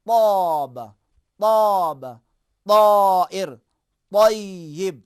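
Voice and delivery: طاب and طیب punched loud with a thick ط; loud, heavy ط in طاب; heavy ta words. Shown as a demonstration. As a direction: drill the heavy ط loudly. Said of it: "اگر موٹا پڑھیں گے".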